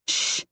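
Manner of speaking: sharp, voiceless shushing hiss; urgent hushed shush. A narrator's reading. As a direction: hiss for silence. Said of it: "I hiss".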